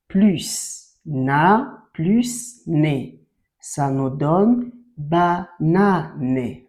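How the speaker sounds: stretched out slowly, syllable by syllable; slow blending. Shown as a demonstration. Teacher to child, building the word banane, slowly sounded out. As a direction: draw each syllable out so a child can hear it join. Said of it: "teacher blends syllables for child".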